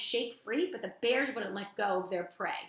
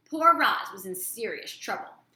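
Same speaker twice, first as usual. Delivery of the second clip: plain reading voice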